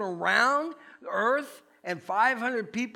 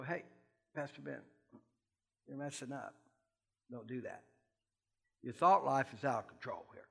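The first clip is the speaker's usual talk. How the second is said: low, much softer voice, a gentle private correction; God's quiet nudge; 6 semitones lower, 7 dB quieter than usual. Retold or imitated. God quietly correcting the pastor, low and soft. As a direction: hush it down like a voice on your shoulder. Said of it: "God will tap me on the shoulder and go".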